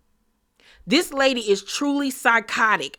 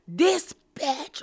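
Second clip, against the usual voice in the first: mock scream; much slower than usual. Retelling Uba's outburst, a mild mock yell. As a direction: imitate a shocked yell, not full force. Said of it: "scream at the top of her lungs".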